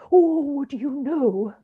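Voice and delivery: high, loud wailing spirit; Marley's ghost demanding belief from Scrooge; ghostly voice. A narrator's reading. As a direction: ring it out like a tormented ghost. The ghost speaks.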